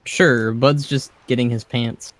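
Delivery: ordinary delivery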